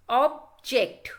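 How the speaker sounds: "object" as two separate syllables. Shown as a demonstration. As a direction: break "object" cleanly in two. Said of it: "any word is actually divided".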